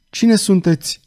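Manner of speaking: high-pitched bewildered question; frantic, distraught. A narrator's reading. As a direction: ask as if out of your mind. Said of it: "cu un glas de ieșit din minți".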